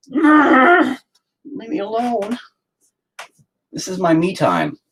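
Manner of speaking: very high, drawn-out whine; dad whining at his kids, high drawn-out; mock whine. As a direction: stretch it out in a whiny wail.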